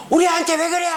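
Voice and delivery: high-pitched mock indignant yell. Mother confronting someone over her child, high mock yell. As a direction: imitate a mother shouting in the street. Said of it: "길바닥에서 소리를 지르고요".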